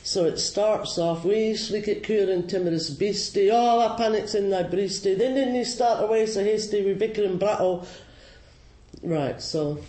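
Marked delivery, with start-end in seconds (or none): 1.2-7.8 loud, higher-pitched broad Scots verse; broad Scots recitation. Acted. Teacher reciting Burns's mouse poem to listeners, broad Scots. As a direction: rattle off the poem in thick Scots, loudly. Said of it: "I won't do the funny accent".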